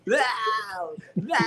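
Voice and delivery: mock crying shout of no; over-the-top Loomis. Showing friends the climactic finish, mock wailed no. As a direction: ham up the desperate no. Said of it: "He's like".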